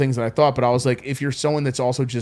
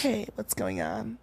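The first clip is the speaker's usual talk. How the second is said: put-on girl voice; 6 semitones lower, 13 dB quieter, slower than usual. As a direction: put on a breathy-free girl voice, lightly. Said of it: "do your best girl voice?".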